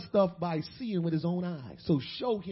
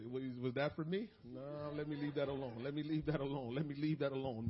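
mock-cautious aside; 7 semitones lower, 9 dB quieter than usual. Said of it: "preacher jokes about avoiding trouble".

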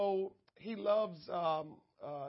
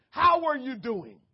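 loud greeting; 4 semitones higher, 12 dB louder than usual. Retold or imitated. Greeting Brother Pugh.

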